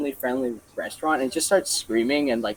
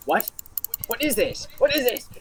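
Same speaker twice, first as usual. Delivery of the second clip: outraged disbelief; 8 semitones higher than usual. Retold or imitated. Drunk lady in disbelief.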